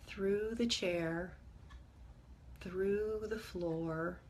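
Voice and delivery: calm, measured meditation guide with long pauses; guided meditation. Acted. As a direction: ease listeners gently inward, leaving space between phrases.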